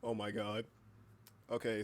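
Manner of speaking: ordinary delivery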